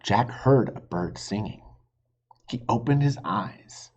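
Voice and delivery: usual speaking voice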